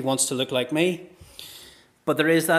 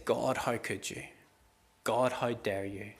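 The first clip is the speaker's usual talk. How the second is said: subdued reproach; 2 semitones lower, 7 dB quieter than usual. Acted. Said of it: "They say".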